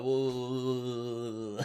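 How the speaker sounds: long droning groan on one note; mocking wordless drone; mock frustrated reaction. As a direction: imitate someone stumped, drawn out. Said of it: "that's my impersonation".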